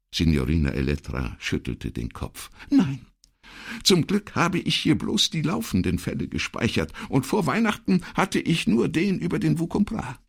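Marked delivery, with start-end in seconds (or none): 2.7-10.2 very high, hurried secretary voice; worried secretary. Acted. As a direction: explain urgently, pitched way up. Voices Elettra in dialogue.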